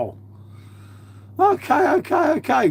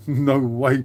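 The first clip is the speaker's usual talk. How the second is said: ordinary talk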